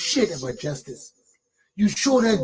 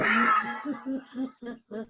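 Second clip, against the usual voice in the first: big scornful burst of laughter; shouted laugh; 2 semitones higher, 7 dB quieter than usual. Real, not acted.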